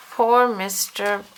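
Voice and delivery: usual speaking voice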